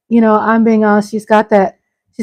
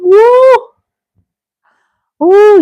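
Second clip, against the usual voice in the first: excited whoop; 11 semitones higher, 5 dB louder than usual. Real, not acted. Celebrating after the song.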